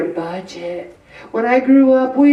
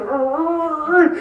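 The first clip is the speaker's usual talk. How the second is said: pained cry; 5 semitones higher than usual. Retold or imitated. Mimics pain of broken tooth.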